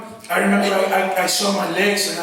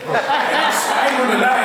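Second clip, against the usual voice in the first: laughing; 6 semitones higher than usual. Real, not acted. Laughing at the snoring captor.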